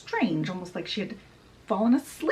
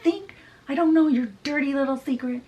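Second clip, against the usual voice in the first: raised, accusing retold rant; mock screaming; 6 semitones higher, 4 dB louder than usual. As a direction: fire it off as a furious mother. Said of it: "Lady Tremaine is screaming".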